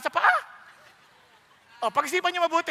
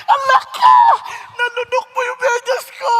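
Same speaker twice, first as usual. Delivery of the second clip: higher, louder shout through laughter; laughing shout; 10 semitones higher, 12 dB louder than usual. Acted. Husband shouting at his wife that she swallowed his sock. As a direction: yell the punchline while laughing.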